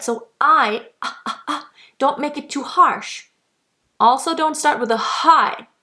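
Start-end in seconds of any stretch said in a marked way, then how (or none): 1.0-1.7 glottal onset. Shown as a demonstration. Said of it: "It's a glottal onset".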